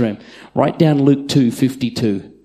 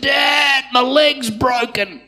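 put-on high, wailing kid in pain; crying child; 12 semitones higher, much slower than usual. Son calling to his dad at midnight, wailing. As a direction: cry out like a hurting boy. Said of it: "He said".

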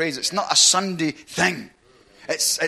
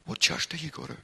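hushed, discreet question; very quietly; 8 dB quieter than usual. Retold or imitated. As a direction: ask it softly, as if leaning over a counter. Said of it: "very quietly".

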